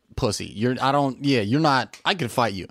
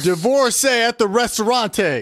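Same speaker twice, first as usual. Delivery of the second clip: loud, high, drawn-out shout; over-enunciated mock scream; 10 semitones higher, 5 dB louder, slower than usual. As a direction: join in with a slow, hammed-up yell. Joining in the screamed dictation bit.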